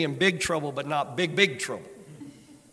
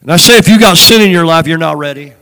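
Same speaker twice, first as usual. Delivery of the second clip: preaching at full force; 14 dB louder than usual. Acted.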